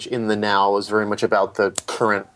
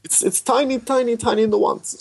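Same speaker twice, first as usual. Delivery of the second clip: lightly mocking French-accented director; 12 semitones higher than usual. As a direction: put on a fond, exaggerated French accent. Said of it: "in his very French accent".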